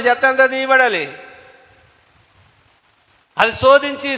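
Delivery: high, ringing pulpit voice; fiery preaching. Acted. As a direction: preach it out with full force to the congregation. Preacher at full force.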